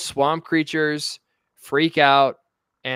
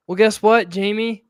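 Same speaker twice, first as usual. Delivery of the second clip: raised, louder challenge to an opponent; indignant rebuke; 6 semitones higher, 6 dB louder than usual. Acted. Host confronting an absent politician about his excuses, indignant. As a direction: call out the politician with heated scorn.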